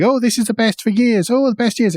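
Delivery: a hammed-up gleeful chorus; mock cheering. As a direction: parody everyone's gushing optimism. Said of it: "so everybody's going".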